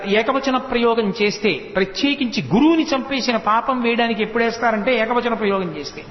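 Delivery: ordinary talk, nothing marked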